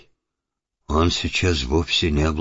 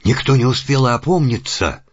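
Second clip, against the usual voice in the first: rising tension; 7 semitones higher, 3 dB louder than usual. A narrator's reading. Narration of sudden murder.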